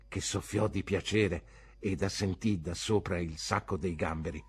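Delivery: ordinary delivery, nothing marked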